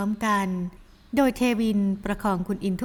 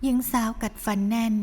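usual speaking voice

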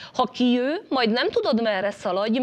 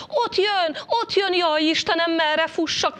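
high, louder cry of alarm; panicked cry; 6 semitones higher, 5 dB louder than usual. A narrator's reading. Little rabbit seeing the cat king coming, panicked cry. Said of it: "kiáltott a kis nyúl".

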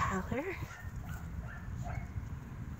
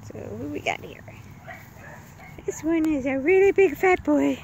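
ordinary delivery

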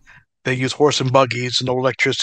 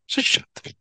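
muttered; 7 semitones higher, 7 dB quieter than usual. Real, not acted. Muttered aside.

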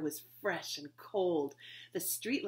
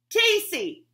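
high, loud shout; 12 semitones higher, 14 dB louder than usual. Acted. Tacy shouting her name at Betsy from the door. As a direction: shout your own name across the yard. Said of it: "she shouted".

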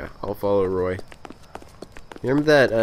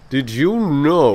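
slow drawling Texan twang, louder; Texan accent; 5 semitones higher, 6 dB louder, much slower than usual. Pretend. Wondering aloud to the chat in a Texan accent. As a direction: ham up a Texas drawl. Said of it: "Sorry if you don't like that accent".

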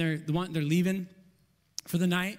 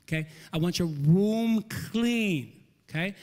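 deliberate parent, key words stretched slowly; parent instructing child; slower than usual. Acted. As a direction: spell it out slowly for a little child. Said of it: "look in their eyes and say".

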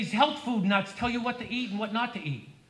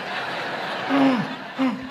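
sharp comic gasp of fright; mock panicked gasp. Comic acting out a morning health scare with a gasp. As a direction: gasp like you felt your heart stop.

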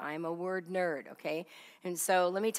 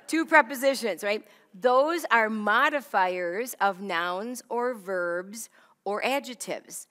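loud teaching emphasis; 2 semitones higher, 7 dB louder than usual. Real, not acted. Teacher stressing a key point.

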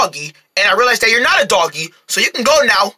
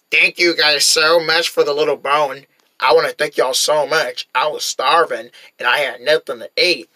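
cowboy voice; 7 semitones lower than usual. Acted. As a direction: thank them like an earnest low-voiced cowboy. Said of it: "it just started talking like a cowboy".

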